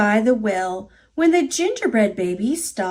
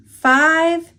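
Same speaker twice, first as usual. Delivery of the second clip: ordinary delivery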